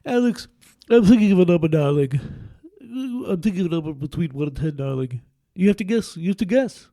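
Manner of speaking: put-on nasal held-nose old-school conductor voice; Maestro voice. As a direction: pinch your nose and purr like a maestro. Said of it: "plug my nose to do the Maestro voice".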